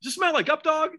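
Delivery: high-pitched fake-innocent insistence; doubling down. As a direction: press the prank question, playing it straight. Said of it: "just stuck with it, doubled down".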